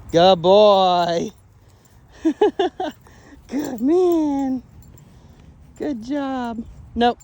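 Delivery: loud, high praise for the dog; excited praise. Real, not acted. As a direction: reward the dog with your voice.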